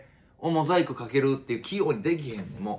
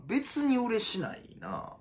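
higher but softer, slowed mutter; softer repeat; 8 semitones higher, 7 dB quieter, much slower than usual. Acted. Comedian repeating his denial, quietly to himself. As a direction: say it again under your breath. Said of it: "said again softer".

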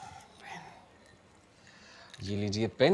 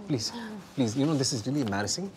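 ordinary talk

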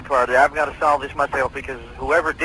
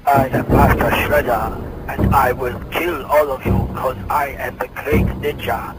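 slow, menacing cartoon villain growl; Shredder villain voice. Cop asks him for the Shredder voice, menacing villain. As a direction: boom it out like a ninja supervillain. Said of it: "do your voice of the, of, uh, the Shredder guy".

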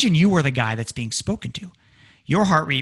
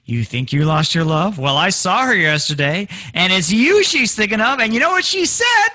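climbing higher and louder with each phrase; suspense-building tease; 4 semitones higher, 6 dB louder than usual. Acted. Jerk friend making you sweat, teasing rise in pitch and volume. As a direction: draw out the suspense, loving it. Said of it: "he loves the fact that he's making you sweat".

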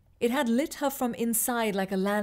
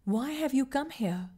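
smooth spirit voice; 4 semitones higher than usual. Acted. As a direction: ask it like a calm, strange being. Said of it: "smooth, melodic voice that was not quite a man's nor a woman's".

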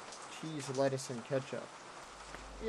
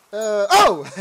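loud, higher startled "oh"; 5 semitones higher, 14 dB louder than usual. Real, not acted. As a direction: break off with a sudden shout of surprise. Startled by arriving customer.

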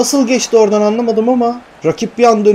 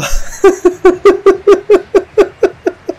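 long, high, helpless laugh; high laughing fit; 14 semitones higher, 4 dB louder than usual. Real, not acted. Gamer cracking up after his meltdown, high laughing fit. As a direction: burst into shrill-pitched laughter.

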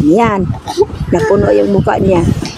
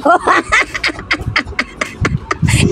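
trying not to laugh; 9 semitones higher, 5 dB quieter than usual. Real, not acted. Stifled laugh.

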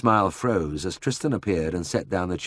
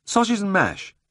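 said lightly; 13 semitones higher than usual. A narrator's reading. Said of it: "he said lightly".

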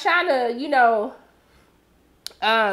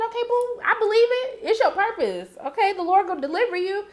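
mock encouragement; 8 semitones higher than usual. Sarcastic reply to Laura Lee.

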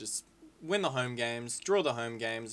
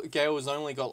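usual speaking voice

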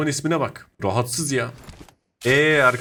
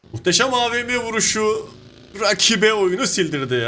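thrilled shout; 9 semitones higher, 3 dB louder than usual. Real, not acted. Celebrating a long sniper kill.

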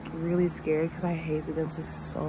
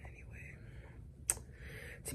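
whisper; 22 dB quieter, much slower than usual. Real, not acted. Whispered aside while filming.

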